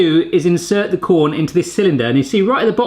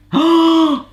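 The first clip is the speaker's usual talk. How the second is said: gasp; 13 semitones higher, 4 dB louder than usual. Real, not acted. Reacts to the cob core coming out.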